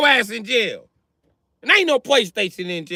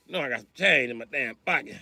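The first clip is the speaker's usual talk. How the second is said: put-on old man muttering; 12 semitones lower, 7 dB quieter, faster than usual.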